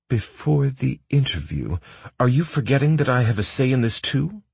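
a hint of an eager young woman; Pearl's voice. A narrator's reading.

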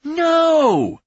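put-on kids' high, loud scream; disgusted scream. A narrator's reading. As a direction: scream it back, high and drawn out. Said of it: "screamed back in disgust".

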